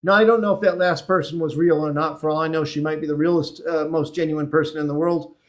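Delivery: usual speaking voice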